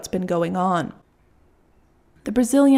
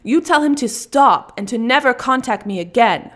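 higher and louder, pressing every item; angry refusal; 4 semitones higher, 5 dB louder than usual. Acted. Russian woman refusing gifts from her stalker. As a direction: reject every gift outright. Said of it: "the Russian says".

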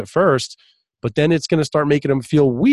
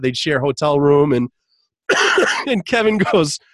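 ordinary delivery

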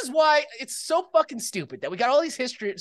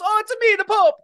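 Pope voice; 11 semitones higher, 6 dB louder than usual. Acted. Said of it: "the Pope goes".

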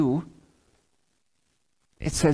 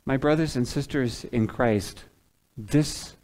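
normal talking voice

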